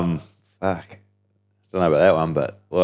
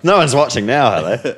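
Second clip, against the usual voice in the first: mock viewer; 5 semitones higher, 4 dB louder than usual. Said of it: "People pro-, people go".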